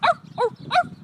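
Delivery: high, loud "arf" barks; dog barking. Acted. Dog making music with friends, high barking. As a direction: yap like a dog. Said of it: "The dog with his".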